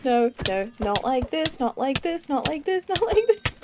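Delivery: rising panic. Real, not acted. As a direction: panic building with each plea. Panicking as he loses the fight.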